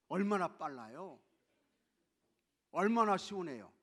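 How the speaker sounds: usual speaking voice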